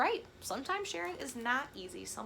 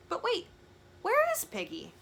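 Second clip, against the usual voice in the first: much higher startled cry; sudden alarm; 13 semitones higher, 5 dB louder than usual. Acted. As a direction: catch yourself in alarm. Sudden worry.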